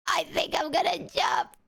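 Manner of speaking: high, breathy, crying whisper; tearful whisper. Real, not acted. Streamer overwhelmed by mods' voices, whispering in tears.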